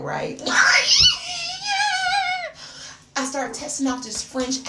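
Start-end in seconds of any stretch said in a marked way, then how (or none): 0.5-2.9 loud, high squealing laugh. Real, not acted. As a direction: shriek with laughter. Laughs at her own accent scheme.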